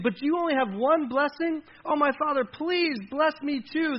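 high, urgent, loud begging; pleading. Acted. Son begging his father for one blessing, pleading. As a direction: plead harder, slowing on the last words. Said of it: "Esau pleaded".

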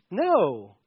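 ordinary talk

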